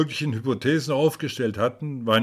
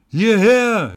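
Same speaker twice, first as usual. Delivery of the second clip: loud, high shout down the stairwell; high-pitched, full-voiced call; heartrending cry; 10 semitones higher, 9 dB louder than usual. A narrator's reading. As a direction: cry out urgently for help. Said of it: "ein herzzerreißendes Geschrei".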